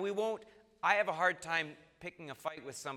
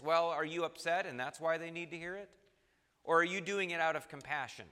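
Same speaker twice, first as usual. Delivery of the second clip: normal talking voice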